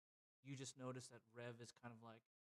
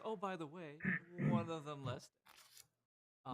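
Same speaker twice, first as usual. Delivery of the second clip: distracted Voldo; 4 semitones higher, 9 dB louder than usual. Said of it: "still checking out this thing and just goes".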